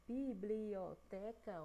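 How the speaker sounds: biblioteca very slow, split bi-bli-o-te-ca; syllable by syllable. Shown as a demonstration. Teacher counting syllables, biblioteca broken into five beats. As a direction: stretch each syllable of biblioteca. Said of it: "cinco sílabas".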